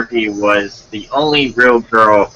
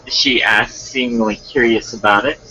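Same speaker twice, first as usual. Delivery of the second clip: ordinary delivery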